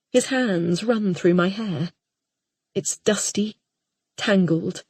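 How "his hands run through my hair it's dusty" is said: ordinary delivery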